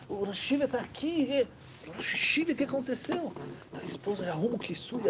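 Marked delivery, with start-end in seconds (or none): 0.1-3.3 high, startled calling out; flustered call. Acted. The husband calling his wife to the door, high and flustered. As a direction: stammer in a panic.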